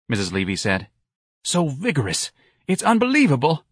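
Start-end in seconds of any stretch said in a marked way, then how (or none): 1.4-3.6 higher, gushing matron; delighted matron. A narrator's reading. Matron admiring the old clerk's spirit. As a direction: marvel at her like a proud patron.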